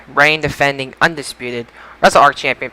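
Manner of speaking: normal talking voice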